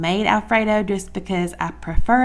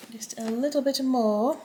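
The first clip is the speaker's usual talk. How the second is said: put-on British accent; 3 semitones higher than usual. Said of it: "Look at you with your British accent".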